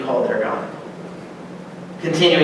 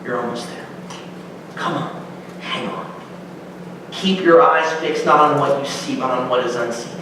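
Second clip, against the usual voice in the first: low, soft coaxing encouragement; gentle reassurance; 4 semitones lower, 5 dB quieter than usual. Acted. Writer of Hebrews comforting exhausted believers, low and soft. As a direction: lean in and gently keep them going. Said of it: "he comes saying".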